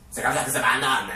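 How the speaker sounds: loud, hammed-up Mexican accent; comic Mexican macho caricature. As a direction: boom it out in a fake Mexican accent. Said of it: "un accent mexicain".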